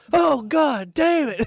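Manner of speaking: high, loud, slow hammed-up scream; mock terrified cry. Hunter pulling a buck from a hole sees a bear, a mock terrified high cry. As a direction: stretch out a comic fright.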